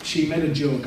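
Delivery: ordinary talk, nothing marked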